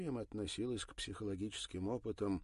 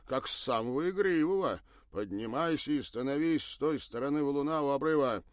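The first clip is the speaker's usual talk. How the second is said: snide; 7 semitones higher, 10 dB louder than usual. Acted. Bernard continues snide order.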